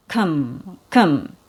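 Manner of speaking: low, unstressed "kum" with a schwa; reduced "kum" without dropping the jaw; neutral syllable. Shown as a demonstration. Teacher showing the neutral first syllable, reduced. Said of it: "So this is neutral".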